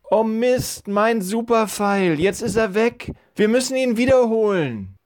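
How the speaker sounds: put-on high, whining boy's lament; dismayed boy. Boy who lost his super arrow over the wall, high dismayed lament. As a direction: wail over your lost treasure in a high voice.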